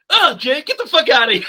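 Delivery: high, laughing brush-off; laughing dismissal. Retold or imitated. Derek shooing the brother away, laughing. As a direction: tell him to get lost through laughter. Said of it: "Derek literally goes".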